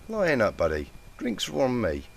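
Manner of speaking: high, cheery tipsy offer; bright and cheerful. A narrator's reading. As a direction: brighten up, buddy-buddy. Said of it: "She immediately brightened".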